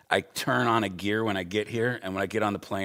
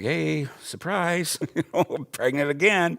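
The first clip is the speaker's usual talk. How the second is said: slow, high, louder teasing announcement; drawn-out playful reveal, pitched up; wife's second surprise; 9 semitones higher, 6 dB louder, slower than usual. Retold or imitated. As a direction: stretch out the surprise like the wife. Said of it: "my wife comes to me, is like".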